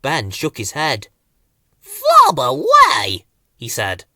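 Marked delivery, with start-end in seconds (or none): 1.8-3.2 high, slow squeaky burble; a toy man's squeaking babble; flowerpot man gibberish. A narrator's reading. Ben cheerfully brushing it off to Bill, in squeaky gibberish.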